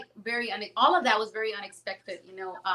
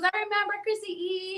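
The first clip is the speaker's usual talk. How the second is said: higher, other-timbre recall; high imitated star; 10 semitones higher, 3 dB quieter than usual. Lou Diamond telling her he remembers, high voiced. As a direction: play the actor fondly.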